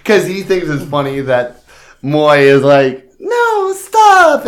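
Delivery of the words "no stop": squeaky high-pitched mock protest; hammed-up high squeal of no, stop. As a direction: squeal it like a flustered aunt. Imitating Moi squealing stop.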